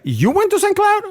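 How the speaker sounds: high astonished query; amazed question. Retold or imitated. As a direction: jump way up in disbelief. Lieutenant amazed at shared town.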